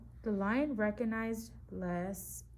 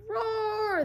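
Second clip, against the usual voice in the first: even higher, fuller lion roar; lion roars again, with more feeling; 12 semitones higher, 7 dB louder than usual. Acted. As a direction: give the roar everything you've got. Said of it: "this time with a little more feeling".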